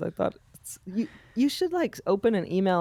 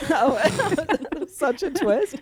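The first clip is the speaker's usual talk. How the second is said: higher, laughing exclamation; 8 semitones higher, 3 dB louder, slower than usual. Real, not acted. Friend laughing at the erased-document joke. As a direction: crack up at the twist.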